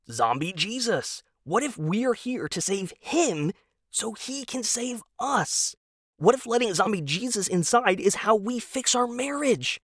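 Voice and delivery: voiced husband, keen and hopeful; eager husband Tom. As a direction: pitch it up like a naive true believer. Tom's eager pitch.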